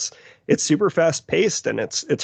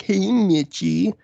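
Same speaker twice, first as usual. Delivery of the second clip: higher, drawn-out mock teasing call; bullying taunt; 6 semitones higher, much slower than usual. Imitating kids who bullied him over his nickname, singsong taunt. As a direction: stretch the nickname out to needle him. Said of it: "I would get bullied to shit".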